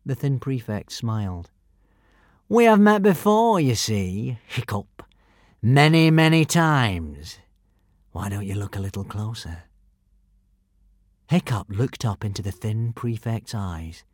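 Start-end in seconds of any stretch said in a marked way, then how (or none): 2.5-9.6 menacing villain. Acted.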